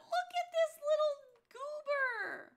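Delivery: high, loud, drawn-out adoring squeal; gushing squeal. Real, not acted. Streamer spotting a cute little creature, gushing. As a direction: coo loudly over something adorable.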